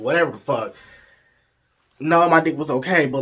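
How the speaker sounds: ordinary delivery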